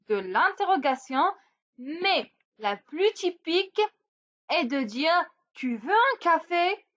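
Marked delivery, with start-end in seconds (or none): 5.5-6.8 «Tu veux un café?» with rising tune at the end; question intonation. Shown as a demonstration. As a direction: lift the pitch on «café». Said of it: "juste changer l'intonation".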